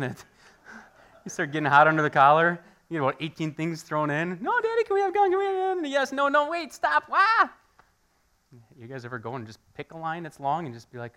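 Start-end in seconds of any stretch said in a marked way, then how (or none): 4.4-5.8 put-on high whiny child begging for gum; squeaky little kid pleading. Pastor voicing his kids begging for gum, high and whiny. 5.8-7.5 frazzled dad. Retold or imitated. Flustered dad in grocery line.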